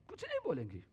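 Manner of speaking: usual speaking voice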